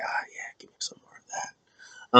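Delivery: breathy mock whisper, high and soft. Dom whispering to Liv while lying on her, hammed-up whisper. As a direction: purr it like a hammed-up creep.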